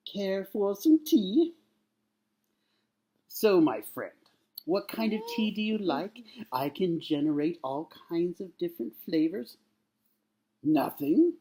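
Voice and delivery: high, hammed-up courteous host; captain addressing an alien. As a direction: offer tea to an alien with exaggerated politeness. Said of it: "talks to one of the aliens".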